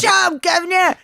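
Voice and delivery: high, loud, laughing mock Cockney; fake British accent. Laughing woman shows her bad Cockney to friends, mock British. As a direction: bark it out in a silly Cockney voice. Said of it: "exact same British accent".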